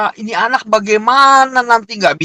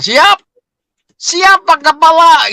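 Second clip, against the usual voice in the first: eager soldier-like reply; 8 semitones higher, 4 dB louder, slower than usual. Retold or imitated.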